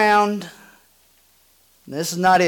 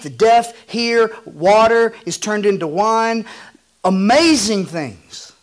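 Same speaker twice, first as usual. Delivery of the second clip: higher, louder, marvelling at the wonders; building excitement; 6 semitones higher, 4 dB louder than usual. Acted. Pastor stirring the church about Jesus' miracles, excited and louder. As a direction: preach the miracles with rising wonder. Preacher voicing Jesus' ministry.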